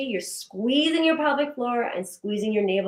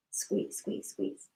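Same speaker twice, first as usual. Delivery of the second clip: whispered coaching; 13 dB quieter, slower than usual. Shown as a demonstration. Coaching a held muscle squeeze.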